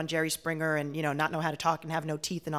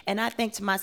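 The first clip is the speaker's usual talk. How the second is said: normal talking voice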